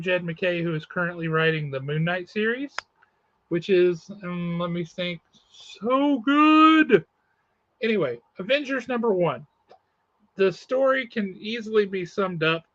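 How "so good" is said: high, loud burst of enthusiasm; emphatic gushing. Real, not acted. Reviewer praising Moon Knight series, emphatic gushing. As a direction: gush like a delighted fan.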